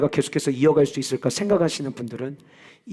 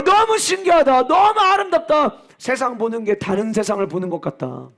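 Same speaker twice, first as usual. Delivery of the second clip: much higher, louder, near-shouted joy; ecstatic believer; 11 semitones higher, 5 dB louder than usual. Acted. Preacher voicing a Spirit-filled believer, ecstatic and much higher. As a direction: cry out in wonder at a Spirit-filled life.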